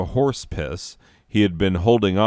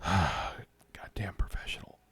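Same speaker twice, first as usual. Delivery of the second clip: whisper; 12 dB quieter than usual. Real, not acted. Sighing, composing himself.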